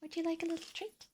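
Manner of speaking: high, soft cooing pet voice; baby talk to a pet. Retold or imitated. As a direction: coo at the pet sweetly.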